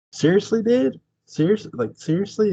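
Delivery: high-pitched disbelieving questions; incredulous. Acted. Co-host reacting to Barnabas's awful luck, incredulous. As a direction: play up the disbelief, voice lifting.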